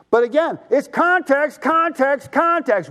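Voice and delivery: high and slow, hammering "context"; preacher stressing "context, context"; emphatic repetition. Acted. Repeated emphatic word.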